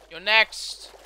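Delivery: loud punch on "next", lifting at the end. Pretend. Gamer taunting a boss to slay, loud mock threat.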